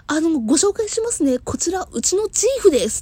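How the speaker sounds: high, loud bright introduction; chatty clerk. Retold or imitated. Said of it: "って言って、チーフ紹介されたんですよ".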